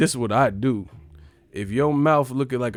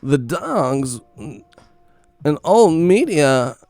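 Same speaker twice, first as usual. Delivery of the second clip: weird voice; 3 semitones higher, 4 dB louder, slower than usual. Retold or imitated. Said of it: "weird voice sounding".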